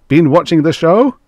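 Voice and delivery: high, loud, cheery question; jovial director. A narrator's reading. Haggard director chats up his boss, loudly jovial. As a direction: ask it with forced joviality. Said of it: "despite the jovial smile".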